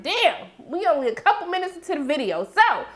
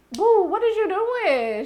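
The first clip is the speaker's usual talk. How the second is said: slow, concerned mock call; 8 semitones higher, slower than usual. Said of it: "our girl had to be like".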